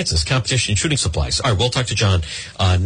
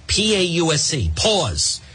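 ordinary talk, nothing marked

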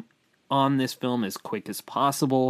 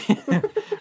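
high giggle. Real, not acted.

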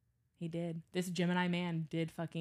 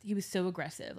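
ordinary delivery

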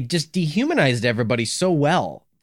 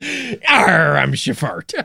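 pirate voice; 5 semitones higher, 5 dB louder, much slower than usual. Pretend. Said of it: "why are you a pirate".

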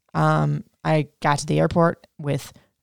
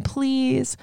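higher, pleading please please please; begging; 8 semitones higher, much slower than usual. Retold or imitated. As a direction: replay the frantic plea at the closed door. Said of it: "I'm begging".

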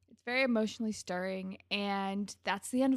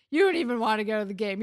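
sneering; 8 dB louder, faster than usual. Retold or imitated. Spinner sneering at Marco.

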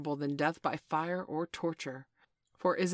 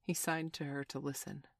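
ordinary delivery

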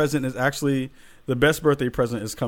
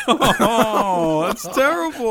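high, loud, breathless laughter; cracking up while talking; laughing hard; 14 semitones higher, 6 dB louder than usual. Real, not acted. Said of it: "Oh, my stomach hurts".

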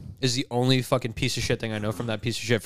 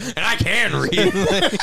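higher, drawn-out mocking echo; laughing, pitched-up mock quote; mimicking co-host; 5 semitones higher, much slower than usual. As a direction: stretch it out slowly through laughter. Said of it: "he's like".

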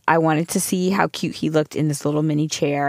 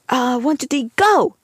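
put-on high, gleeful little-kid countdown; excited kid countdown; 8 semitones higher than usual. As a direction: count down like a toddler starting a race. Son starting a race game.

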